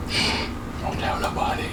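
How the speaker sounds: hushed mock-secret whisper. Joking secret about retiring.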